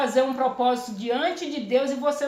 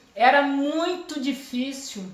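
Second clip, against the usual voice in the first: ordinary talk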